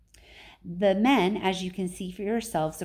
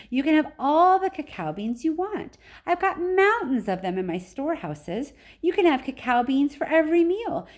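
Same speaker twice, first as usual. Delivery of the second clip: excited offer; 7 semitones higher, 4 dB louder than usual. Acted. Wonka tempts the Oompa Loompa leader.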